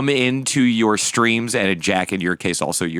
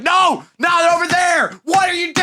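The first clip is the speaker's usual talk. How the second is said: frantic high-pitched mock shouting; exaggerated exasperated hollering; John; 4 dB louder than usual. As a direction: yell panicked orders at a co-op partner. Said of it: "John's like".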